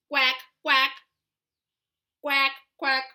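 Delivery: loud quack quack; duck quacking. Shown as a demonstration. Duck sound for child.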